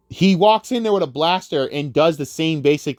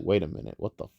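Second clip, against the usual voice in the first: much lower and softer, thinking to himself; hushed confused aside; muttered inner thought; 10 semitones lower, 9 dB quieter than usual. Retold or imitated. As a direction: mutter it under your breath like a private realisation. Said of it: "He's like, think like".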